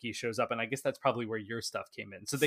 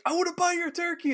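giddy Scrooge; 4 dB louder than usual. Retold or imitated. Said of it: "Scrooge is like".

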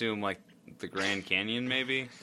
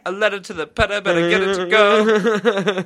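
butler voice; 10 semitones higher, 10 dB louder than usual. Acted. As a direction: croon it like a grand butler. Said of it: "that was my butler voice".

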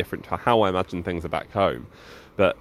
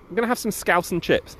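high, bouncy excitement about scouse and chips; pitched-up eager delight; excited gloat; 9 semitones higher than usual. Acted. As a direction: sell it like a treat you can't wait for. Rob gloating about Liverpool trip.

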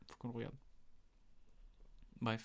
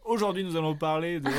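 louder, announcer-style opening; mock show intro; 4 semitones higher, 7 dB louder, faster than usual. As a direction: restart the episode like a host. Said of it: "on recommence l'épisode".

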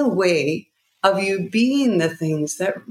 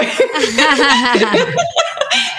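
burst of laughter; 6 semitones higher, 5 dB louder than usual. Real, not acted. Laughs mid-story.

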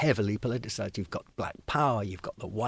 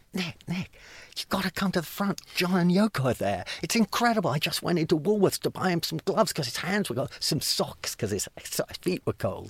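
higher-pitched, urgent retold plea; breathless news delivered in an excited rush of words; excited friend; 8 semitones higher than usual. Friend fetching Nick to the front, excited.

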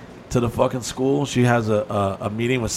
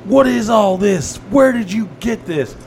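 shrill accusing stepmother; 8 semitones higher, 4 dB louder, slower than usual. Retold or imitated. Stepmother demanding about money.

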